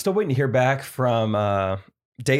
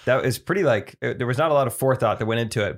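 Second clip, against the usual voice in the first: normal talking voice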